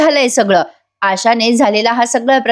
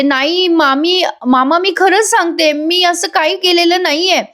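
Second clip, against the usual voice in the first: acted-out earnest appeal to aunt and uncle; pleading denial; 7 semitones higher than usual. Ramya denies it to aunt, uncle.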